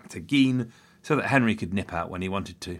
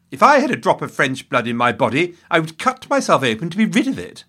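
louder and higher, openly contemptuous; loud scornful outburst; 5 semitones higher, 6 dB louder than usual. Retold or imitated. Lord Leonard Grey insulting the French within earshot, loudly. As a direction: blurt the insult for everyone to hear. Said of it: "says subtly in a voice that only a deaf bloke".

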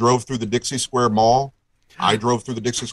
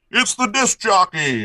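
high, slow, hammed-up announcer greeting; mock disc jockey voice picking up; Brock's phone greeting; 10 semitones higher, slower than usual. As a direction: ham up a radio host answering the phone. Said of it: "is how he would answer the phone".

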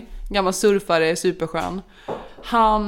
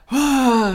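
startled gasp; 5 semitones higher, 7 dB louder than usual. Retold or imitated. Dad catching the falling baby.

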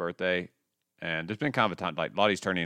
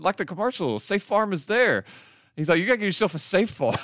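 retold character; 9 semitones higher, 4 dB louder than usual. Acting out retold phone call.

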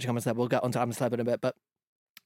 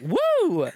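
loud, high song whoop; 12 semitones higher, 5 dB louder than usual. Shown as a demonstration. Imitating the gym song for co-host, high whoop. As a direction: whoop it out like an adrenaline rush. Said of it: "and it's like".

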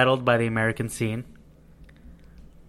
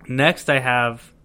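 ordinary delivery

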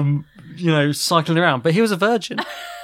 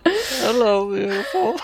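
dreamy mumbled sleep voice, pitched up; sleep talking; 7 semitones higher, much slower than usual. Retold or imitated. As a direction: murmur it half asleep, higher. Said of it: "in his sleep".

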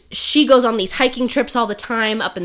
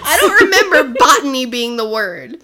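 laughing; 4 semitones higher, 5 dB louder than usual. Real, not acted. Laughing over forgotten word.

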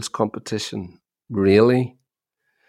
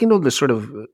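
ordinary talk, nothing marked

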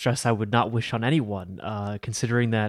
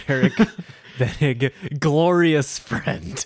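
slow, drawn-out, slightly raised flourish; grand title readout; 3 semitones higher, much slower than usual. Pretend. Co-host reading out his new business card title, slow and grand.

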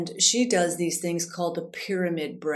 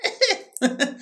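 laughing; 14 semitones higher than usual. Real, not acted. Laughs after coughing.

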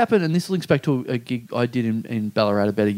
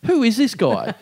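higher, baffled question; puzzled fan; 10 semitones higher than usual. Retold or imitated. A fan asking Tommy about Dave, high baffled question. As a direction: turn and demand who he is.

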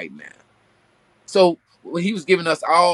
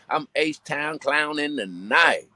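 ordinary talk

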